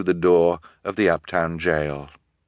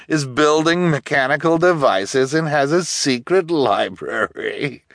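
incredulous officer, high and louder; scornful police officer; 8 semitones higher, 4 dB louder than usual. A narrator's reading. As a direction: scoff at a ridiculous story.

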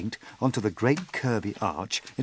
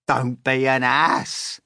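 slow, loud, high put-down; scornful scolding; 5 semitones higher, 7 dB louder, slower than usual. A narrator's reading. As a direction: scold the fool slowly and loudly. Centipede scolds the earthworm.

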